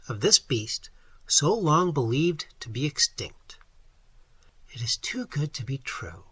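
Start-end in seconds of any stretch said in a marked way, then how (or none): 4.7-6.2 soft sigh, much softer. A narrator's reading. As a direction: breathe it out with a sigh of rapture. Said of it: "sighed Dorothy".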